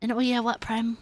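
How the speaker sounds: usual speaking voice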